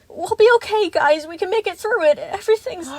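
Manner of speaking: high, loud, pleading voice; anxious reassurance. A narrator's reading. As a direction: rush in to smooth things over. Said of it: "fiddling anxiously with her arm warmers".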